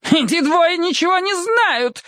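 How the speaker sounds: shrieky tipsy lady; squealing woman. A narrator's reading. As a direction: squeal it in outrage, slowly. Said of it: "взвизгнула женщина".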